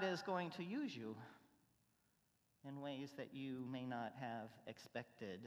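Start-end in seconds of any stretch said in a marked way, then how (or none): none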